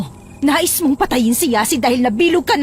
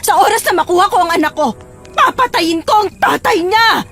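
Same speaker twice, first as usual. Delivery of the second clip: high, tearful vow of revenge; galit na banta; 8 semitones higher, 3 dB louder than usual. Acted. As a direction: threaten through angry tears, voice raised high. Mother vows to kill father. (galit at naiiyak na pagbabanta)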